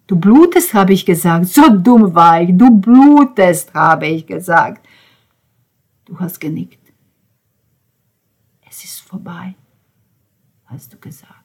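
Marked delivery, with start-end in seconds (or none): 2.6-3.7 anguished. Acted. Repeated, slower, louder. 8.7-11.3 whisper. Acted. Whispered echo.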